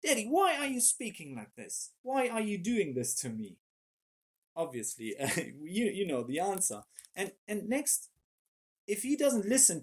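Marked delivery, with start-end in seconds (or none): 0.0-3.6 higher, mock-hurt little boy voice; lightly voiced little son complaining to daddy; child's protest. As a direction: plead like a wounded toddler. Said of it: "he would say something like".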